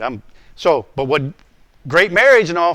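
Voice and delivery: normal talking voice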